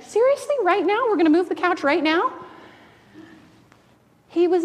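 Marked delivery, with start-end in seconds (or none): 0.0-2.3 much higher disbelieving questions; high, rising mock protest; incredulous. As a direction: balk at doing it this instant. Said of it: "we'd be like".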